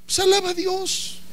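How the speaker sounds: high exhortation. Acted. Preacher exhorting.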